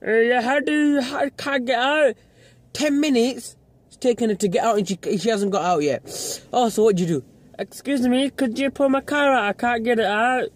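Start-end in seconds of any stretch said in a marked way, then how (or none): 0.1-2.2 high, mumbled helpless-driver impression; mocking the woman. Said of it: "the other woman's just like". 7.6-10.5 high-pitched stuck-driver impression; mock helpless request. Acts out the stuck driver asking.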